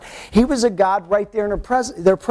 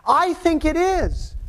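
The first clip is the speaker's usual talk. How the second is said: higher and slow, mock insisting; insistent believer; 9 semitones higher, slower than usual. Believer insisting to the pastor that it's time, higher and slow. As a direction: press the point, slowly and higher. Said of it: "You say".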